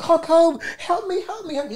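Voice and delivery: high, mock panicked pleas for help; teen in a panic attack calling out; panicked shout. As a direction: retell a frightened cry of help me, high up. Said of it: "He's shouting".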